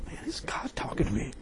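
whispery, very soft question; hushed wondering. Retold or imitated. Preacher wondering if God is talking, hushed disbelief. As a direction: ask it under the breath, as a private thought. Retold awed inner thought.

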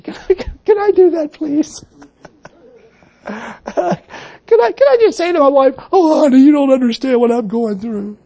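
high, wheedling plea for sympathy; whiny self-pity. Retold or imitated. The preacher pleading with his wife for pity, high and whiny. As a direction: beg to be petted, half-laughing.

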